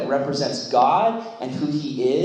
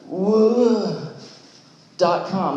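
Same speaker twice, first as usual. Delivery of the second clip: mock amazed reader; 6 semitones higher, much slower than usual. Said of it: "you're like".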